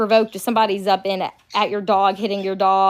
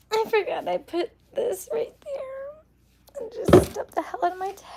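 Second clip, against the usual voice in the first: stubbed toe; 13 semitones higher, 4 dB quieter than usual. Real, not acted. Pained after stubbing toe.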